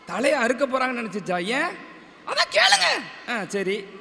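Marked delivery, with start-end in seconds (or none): 2.3-2.8 very high puppet-character voice; டிங்கன். Pretend. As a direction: call out to the crowd in a squeaky high voice. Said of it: "டிங்கன் வந்து ஒரு கதை". (மிக உயர்ந்த பொம்மைக் குரலில்)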